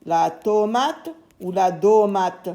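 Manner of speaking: slowly weighing la tomate against la domate; teacher offering two guesses slowly; slow comparison. Shown as a demonstration. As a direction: say both options slowly for children. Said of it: "On va dire".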